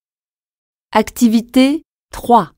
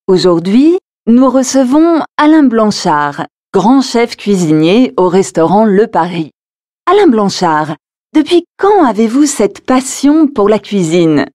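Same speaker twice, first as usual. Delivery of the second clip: louder, lively radio intro; radio host; 3 semitones lower, 4 dB louder, faster than usual. Acted. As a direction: present the guest like a radio host. (animateur radio)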